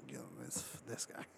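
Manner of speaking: very soft, voiceless aside; near-whispered dismissal; mock disbelief under the breath. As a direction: mimic a quietly unimpressed onlooker. Imitates woman unimpressed at his fall.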